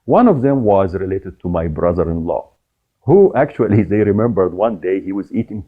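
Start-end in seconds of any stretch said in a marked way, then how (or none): none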